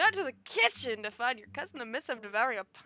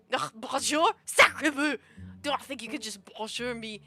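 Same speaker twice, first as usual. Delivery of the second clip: haughty, indignant French accent, badly faked; 5 dB louder than usual. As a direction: put on a hammy French accent, full of disdain. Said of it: "I can't do a French accent".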